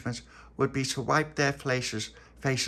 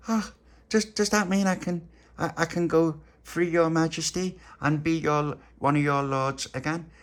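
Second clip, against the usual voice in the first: high, eager pleading; hopeful prisoner; 7 semitones higher, 3 dB louder than usual. Acted. Tony eagerly asking the queen if he can go free. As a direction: beg hopefully for freedom.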